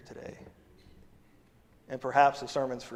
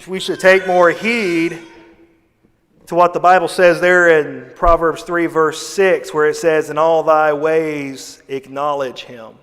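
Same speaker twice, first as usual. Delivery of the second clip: loud, slow, booming preacher cadence; full-force preaching; 10 dB louder, slower than usual. Acted. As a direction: proclaim the verse to the whole church.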